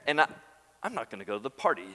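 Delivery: a hint of a sulky brother, rising then dropping; mock sulk. Preacher mocking older brother's refusal, lightly sulky. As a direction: scoff at the feast then refuse it. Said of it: "To say".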